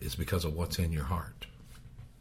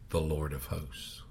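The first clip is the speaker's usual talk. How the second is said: whisper; 4 semitones lower, 11 dB quieter than usual. Real, not acted. Preacher dropping voice for awe.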